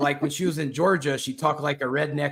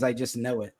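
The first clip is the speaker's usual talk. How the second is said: ordinary talk, nothing marked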